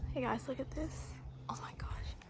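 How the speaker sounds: soft hushed whisper. Real, not acted. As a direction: breathe it so the bug stays. Hushed near the insects.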